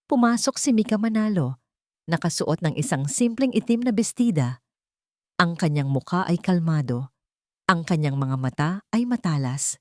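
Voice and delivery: usual speaking voice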